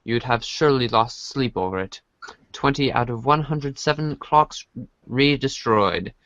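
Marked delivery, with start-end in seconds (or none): none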